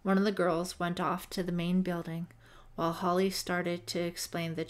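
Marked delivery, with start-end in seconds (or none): none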